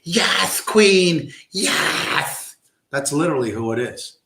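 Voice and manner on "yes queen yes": high, mincing mock cheer; mock bug man. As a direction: camp it up, higher and airy. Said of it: "some bug man".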